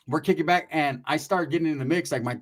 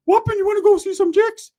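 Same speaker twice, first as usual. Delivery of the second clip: very high, loud cartoon squeak; 8 dB louder than usual. [name] squealing at his cousin in a Mickey Mouse voice. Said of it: "from Mickey Mouse to [name]".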